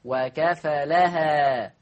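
"وكفلها" mit einfachem Fa, sehr langsam; "وكفلها" leicht, Fa nicht betont; Fa ohne Verdopplung. Shown as a demonstration. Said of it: "بتخفيف الفاء".

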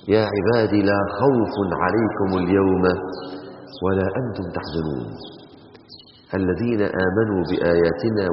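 slow, low melodic recitation; measured tajweed reading; Quran recitation. Acted. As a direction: recite it slowly as from the mushaf.

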